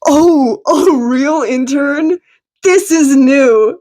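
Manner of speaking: a hint of playful astonishment; amused surprise. A narrator's reading. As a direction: tease with mock wonder.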